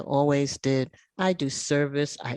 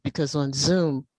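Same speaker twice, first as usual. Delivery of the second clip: normal talking voice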